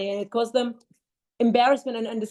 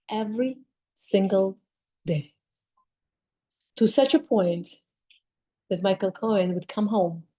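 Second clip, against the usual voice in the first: ordinary talk, nothing marked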